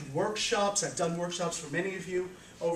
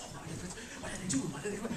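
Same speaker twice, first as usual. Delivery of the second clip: fast and much softer, wondering aloud; hushed eager question; 10 dB quieter, much faster than usual. Retold or imitated. Wise people whispering together, fast, eager and hushed. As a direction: rush the question under your breath. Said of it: "They said".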